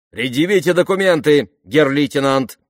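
loud, high, harsh official challenge; sharp demand. A narrator's reading. Soldier at checkpoint challenges a lieutenant, sharp demand. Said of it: "потребовал резкий голос".

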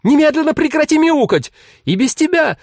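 mother's furious shout. A narrator's reading. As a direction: yell at her daughter to stop. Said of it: "она закричала".

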